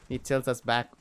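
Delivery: usual speaking voice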